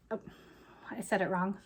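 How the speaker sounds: under the breath, slow and low; quiet aside. Real, not acted. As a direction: mutter it to yourself, slowly. Quick self-correcting aside.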